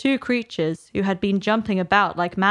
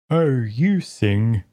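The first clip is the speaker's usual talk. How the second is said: low, gruff creature voice; a rumbling Griffin; 8 semitones lower, 3 dB louder, much slower than usual. Acted. Griffin starts explaining the dance, low and gruff.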